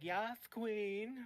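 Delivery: high, drawn-out sassy cheer; sassy hype catchphrase. Acted. Co-host demonstrating sassy talk, high and drawn out. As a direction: stretch it out high, like an over-the-top compliment. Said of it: "it'll be like".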